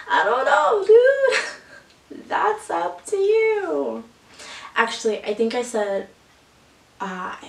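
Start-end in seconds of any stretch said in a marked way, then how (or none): 0.1-4.0 high, loud singsong dodge; mock noncommittal reply. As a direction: pitch it way up, drawing out the end. Acted reply dodging his invitation.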